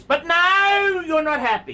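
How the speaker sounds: high, loud, fed-up outburst; mocking exasperated shout. Real, not acted. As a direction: burst out at his endless complaining. Scolding the owner.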